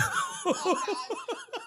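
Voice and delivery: squealing laugh; high breathless shriek of laughter. Real, not acted. Watching him eat.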